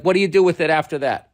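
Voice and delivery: normal talking voice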